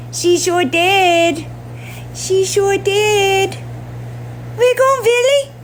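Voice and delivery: high, slow cooing to a kitten; drawn-out squeaky pet talk. Real, not acted. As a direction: draw it out sweetly up high. Slow baby talk to cat.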